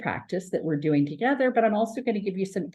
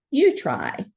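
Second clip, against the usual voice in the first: encouraging teacher prompt; 8 semitones higher, 5 dB louder than usual. Shown as a demonstration.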